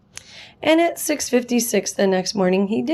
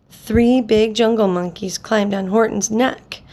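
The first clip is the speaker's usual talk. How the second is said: ordinary delivery, nothing marked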